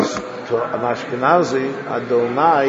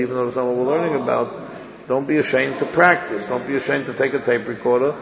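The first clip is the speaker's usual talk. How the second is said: ordinary talk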